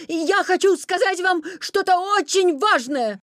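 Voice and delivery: high, loud, urgent appeal; shouting. A narrator's reading. Petya trying to stop the Wolf, urgent high shouting. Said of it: "крикнул Петя".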